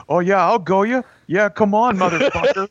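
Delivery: high-pitched mock Canadian challenge; hostile Canadian accent. Imitating a Canadian dude starting a fight, hostile Canadian accent. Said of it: "I also really enjoy Canadian accents".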